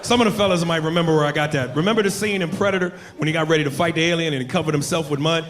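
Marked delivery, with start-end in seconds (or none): none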